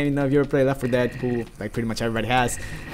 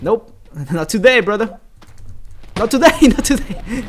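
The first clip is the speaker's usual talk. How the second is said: higher, louder taunt through laughter; laughing taunt; 8 semitones higher, 6 dB louder than usual. Real, not acted. Gamer mocking an enemy in game, a higher, louder laughing taunt. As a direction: laugh in its face as you dodge.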